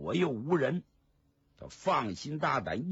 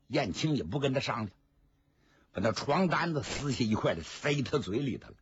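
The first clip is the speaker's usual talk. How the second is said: ordinary delivery, nothing marked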